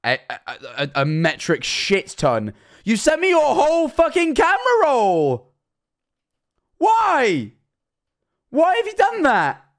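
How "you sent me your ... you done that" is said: high, loud disbelieving outburst; exasperated shout. Real, not acted. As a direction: yell it at the screen in disbelief. Exasperated at a flood of images.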